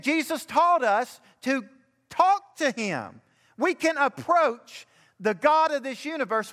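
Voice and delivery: high, emphatic sermon delivery; preaching. Acted. Pastor preaching to the congregation about the Heavenly Father, fervent. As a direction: preach it with rising conviction.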